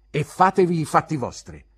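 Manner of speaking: loud, high, insolent dismissal; heated pupil answering back; defiant retort. Acted. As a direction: tell the teacher off. Pupil defies teacher.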